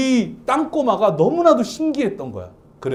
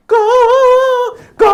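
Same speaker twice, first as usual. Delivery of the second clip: happy crow call; 8 dB louder than usual. Retold or imitated. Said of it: "행복할 때 우는 소리를".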